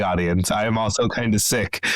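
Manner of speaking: normal talking voice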